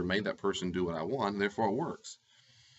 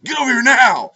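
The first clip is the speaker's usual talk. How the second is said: full-volume furious shout, pitched way up; yelling at a child; 18 dB louder than usual. Shown as a demonstration. Parent screaming at a kid to come over, furious shout. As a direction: bark it at the top of your lungs like an enraged parent. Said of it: "That kind of yelling and screaming intensity".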